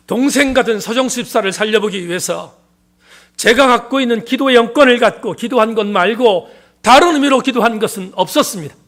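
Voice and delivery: loud, impassioned preacher's cry; fervent preaching. Real, not acted. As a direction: proclaim it at full force from the pulpit.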